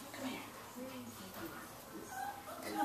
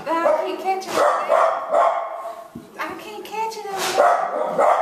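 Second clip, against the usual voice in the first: voicing the dog; 7 semitones higher, 4 dB louder than usual. Owner voices dog's complaint.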